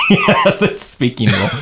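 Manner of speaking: high-pitched, laughing repeat. Real, not acted. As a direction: say it through helpless giggles. Repeats friend's line while cracking up.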